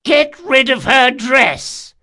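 high, loud, slow shout. A narrator's reading. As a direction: bark the order out. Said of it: "he shouted".

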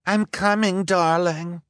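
high, loud sing-out reply; calling back. A narrator's reading. Villainess calls to her boyfriend through smoke, calling back. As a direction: call back from a distance.

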